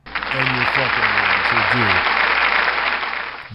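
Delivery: shouting the name line out loud. Real, not acted. Streamer yelling at chat about a username, loud. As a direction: bellow it at chat, mock outraged.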